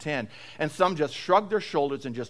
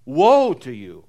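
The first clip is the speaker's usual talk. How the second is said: solemn woe; 3 semitones lower, 6 dB louder, slower than usual. Retold or imitated. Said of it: "Words of judgment brought together with a sense of pity and sorrow".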